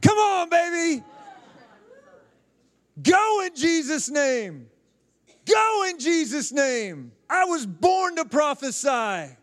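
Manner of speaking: high, loud preacher's battle cry; rallying shout. Real, not acted. Preacher urges congregation to answer the taunts, rallying shout. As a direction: shout it out with drawn-out, falling phrases.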